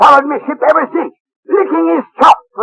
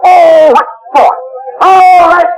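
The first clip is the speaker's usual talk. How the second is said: ordinary delivery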